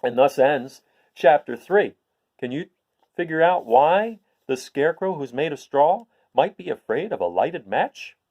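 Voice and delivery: gentle storyteller's question; reading to children. A narrator's reading. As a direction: ask the little listeners to think.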